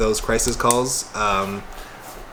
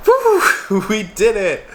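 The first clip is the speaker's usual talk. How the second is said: a much higher whoop breaking into a laugh; ecstatic cheer; 10 semitones higher, 4 dB louder, much slower than usual. Retold or imitated. Crisis worker cheering after the caller got off the tracks, ecstatic whoop. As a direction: let out a giddy victory cry. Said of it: "I was just kind of ecstatic".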